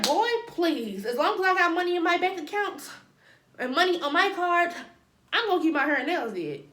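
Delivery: high, loud sassy comeback; sassy retort. Retold or imitated. Telling the boy from class off with loud attitude. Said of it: "I said".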